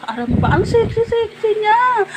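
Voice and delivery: high, delighted gush. Real, not acted. Telling viewers about Amor now, gushing high. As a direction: rave about how good she looks.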